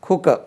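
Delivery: "குக்கர்" as khu-ka, final r silent; correct pronunciation. Shown as a demonstration. Teacher modelling before the warning, "குக்கர்" as khu-ka. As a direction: say "குக்கர்" with puffed k and no r. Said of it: "குக்கர் சொல்லாதீங்க".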